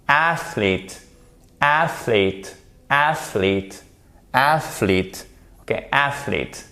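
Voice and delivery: athlete with the first E as a long I; English athlete. Shown as a demonstration. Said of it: "Vous avez votre accentuation ici". (athlete, le E dit comme un I long)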